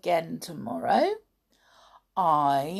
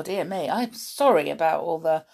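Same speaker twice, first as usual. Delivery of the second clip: plain reading voice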